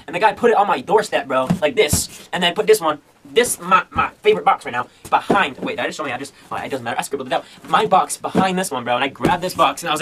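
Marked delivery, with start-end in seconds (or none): none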